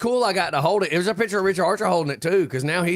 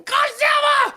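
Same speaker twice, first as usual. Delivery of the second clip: very high, loud comic scream; mock movie panic; 6 dB louder, slower than usual. Host imitates man in monster movie spotting Godzilla, mock panicked shout. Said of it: "the Chinese guy going".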